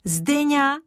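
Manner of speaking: put-on child crying out a name in joy; excited cry. A narrator's reading. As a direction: call the name out in delight. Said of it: "zvolal Kája".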